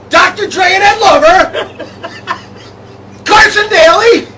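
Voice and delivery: rising high, loud giddy shouting; excited shout. Acted. Co-host listing VJs, building to excited shouting. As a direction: build it up to a yell.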